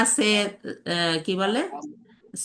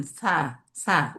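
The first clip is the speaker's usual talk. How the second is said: সা, সা drawn out slowly; slow demo; 3 semitones lower, much slower than usual. Shown as a demonstration. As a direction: stretch সা slowly twice. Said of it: "সাটা দেখেন". (ধীরে টেনে সা, সা)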